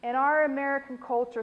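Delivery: normal talking voice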